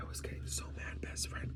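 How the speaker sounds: furious words whispered with no voice; a seething whisper; whispered anger. Real, not acted. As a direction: hiss it out under your breath in a rage. Telling of rising anger.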